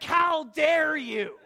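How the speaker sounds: loud, slow indignant shout; angry rebuke. Acted. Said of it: "That's more the tone of it".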